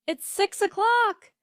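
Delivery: loud, high call upstairs; shouted. A narrator's reading. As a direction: shout the time up the stairs. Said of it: "his mother shouted".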